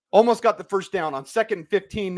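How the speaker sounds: ordinary talk, nothing marked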